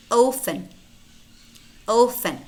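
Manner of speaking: Open, meant as often, said "offen"; no T in the word written Open, an F sound inside; silent T. Shown as a demonstration. Teacher models silent letters for learners, silent T in often. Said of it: "but it's not pronounced".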